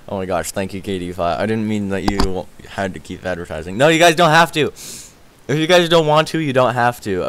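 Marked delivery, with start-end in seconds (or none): 3.8-7.2 higher, louder hurried insisting; flustered, louder insisting; urgent reassurance. Real, not acted. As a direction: urge them not to feel obliged. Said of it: "I feel so bad".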